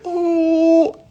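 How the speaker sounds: high, fake pitying coo; mock pity. Mocking coddling sympathy, high pitying coo. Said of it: "They're not gonna be like".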